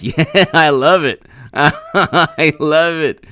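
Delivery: laughing through the words; real laughter while reading. Real, not acted. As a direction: let the laugh carry the line, loud. Laughing at the donation.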